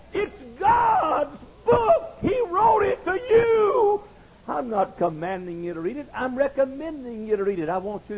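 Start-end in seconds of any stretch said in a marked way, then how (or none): 0.1-4.0 very high, drawn-out proclamation; climactic preaching cry. Real, not acted. Preacher tells congregation whose book it is, crying it out slowly. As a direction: stretch every word high and ringing.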